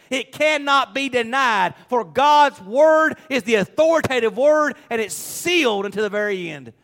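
high, emphatic pulpit declaration; forceful preaching. Real, not acted. Preacher assuring people of God's word, forceful preaching. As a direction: hammer the promise home, building in force.

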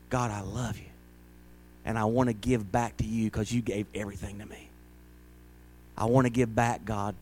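quiet, low, tender prayer to God; soft devoted prayer. Pretend. Pastor voices a believer's soft loving prayer. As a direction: pray it gently from the heart.

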